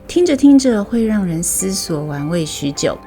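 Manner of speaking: plain reading voice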